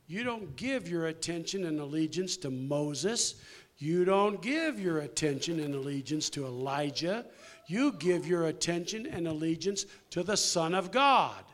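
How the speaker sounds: preaching. Acted. Preaching the point.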